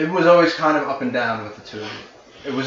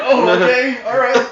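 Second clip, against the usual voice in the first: higher and louder, laughing through a crushing hug; squeezed, surprised; 12 semitones higher, 10 dB louder than usual. Acted. Caught in a tight hug, surprised and laughing. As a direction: gasp it out while being squeezed. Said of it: "You're like".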